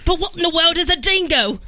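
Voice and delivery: high, put-on Aussie voice; playful Australian accent, pitched high. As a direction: ask in baffled mock Australian. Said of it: "she's gonna be the croc hunter".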